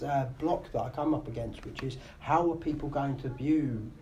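ordinary talk

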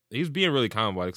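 normal talking voice

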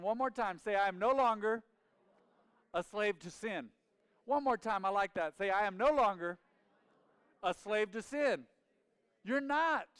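loud, high rallying cry; full-force preaching. Real, not acted. Preacher rallying the church to repeat it, loud and fervent. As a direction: drive the chant home.

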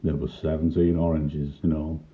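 ordinary delivery

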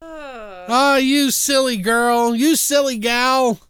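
high, slow singsong silly voice; mock chiding. Podcaster teases killer Mary Lou, high mock chiding. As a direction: draw it out in a hammed-up high croon.

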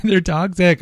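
high, mock-ominous repeat of they're toxic; mock dramatic. Host hamming up the danger of candles, mock alarm. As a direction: raise the pitch and lean on toxic with fake alarm.

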